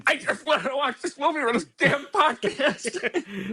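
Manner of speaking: high, slow whine through laughter; mock whining. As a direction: whimper a hammed-up complaint, laughing. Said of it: "It's like".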